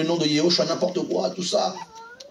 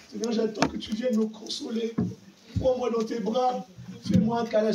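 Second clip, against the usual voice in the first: put-on whiny baby believer; 6 semitones higher, 5 dB quieter than usual. Preacher mocking a sulking believer, mild whine. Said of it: "tu faisais le bébé".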